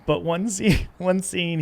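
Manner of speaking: ordinary talk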